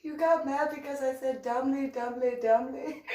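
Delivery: high giggling teasing about "domly"; laughing tease. Real, not acted. Teasing Coyote about "domly" while giggling. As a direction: tease him, barely holding the laughter.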